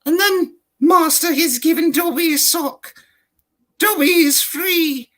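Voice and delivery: Dobby impression. Shown as a demonstration. Showing off his Dobby voice to the host. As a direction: squeak it like a freed house-elf. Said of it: "transition from one to the other".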